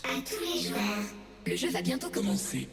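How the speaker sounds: dry robotic French announcement; robot voice without the extra processing; plain robot voice. Shown as a demonstration. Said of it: "to make this robotic voice".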